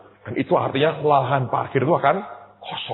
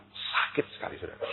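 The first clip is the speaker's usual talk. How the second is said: pained whisper; 8 semitones lower, 15 dB quieter than usual. Real, not acted. Telling of his inner hurt.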